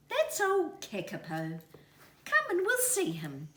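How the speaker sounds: cheerful mother leading the way; mother kiwi. A narrator's reading. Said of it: "Mother Kiwi said".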